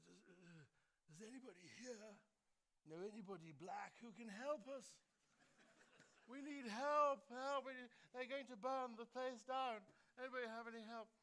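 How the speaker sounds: high put-on voice building to a loud plea; Margaret Thatcher impression. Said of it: "I'm gonna use her voice".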